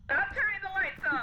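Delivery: loud frustrated yell; shouting. Real, not acted. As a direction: shout at the ghost to stop. Yelling at the ghost.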